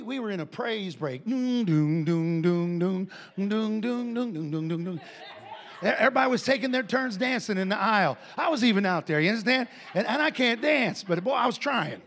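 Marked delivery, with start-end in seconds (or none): 1.2-5.0 low rhythmic mouthed bass line; chugging doom-doom vocal riff, starting loud; imitated bass riff. Imitating praise break music. 5.8-12.0 loud, animated, tumbling retelling; boisterous and amused; excited storytelling. Real, not acted.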